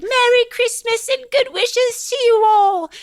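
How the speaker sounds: put-on very high child falsetto; Tiny Tim wishing everyone well. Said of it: "Tiny Tim says something like".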